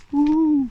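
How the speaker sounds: high, loud whoop of delight; playful woo. Real, not acted. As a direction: let out a bright woo. Playful exclamation on the walk.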